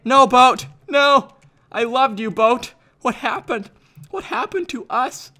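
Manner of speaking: high, hammed-up wail of loss; mock heartbreak. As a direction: wail over a lost love. Mourns his lost boat.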